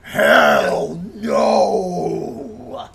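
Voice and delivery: hammy wrestler promo ending in a yell; Hulk Hogan voice. Retold or imitated. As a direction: build to a shouted hell no. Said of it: "he's like".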